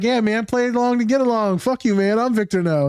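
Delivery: janitor character. Retold or imitated. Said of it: "he's like".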